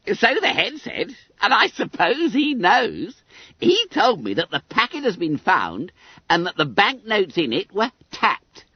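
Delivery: high, squeaky schoolboy voice; self-satisfied Billy Bunter. A narrator's reading. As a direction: pipe it up high, full of importance.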